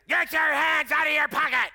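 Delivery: imitating a rough, screamed command; Mahesh Shavda. Guest preacher screaming at him from the stage, rough imitated shout. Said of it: "And he screams again".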